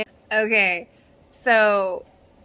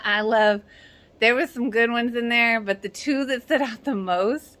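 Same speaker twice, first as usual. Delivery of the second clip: ordinary talk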